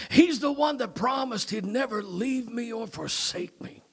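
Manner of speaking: normal talking voice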